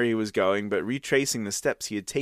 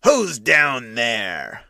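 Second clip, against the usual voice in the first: slow, heavy menacing challenge; fierce bark; 4 dB louder, much slower than usual. Acted. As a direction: bark it down like a bouncer. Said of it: "barked at him fiercely".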